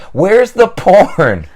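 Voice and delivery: put-on high, slow, loud child yell; little girl calling out for the popcorn in the store; child screaming. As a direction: shout across the store like a lost kid. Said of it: "starts screaming at the top of her lungs".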